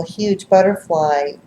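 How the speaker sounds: ordinary talk, nothing marked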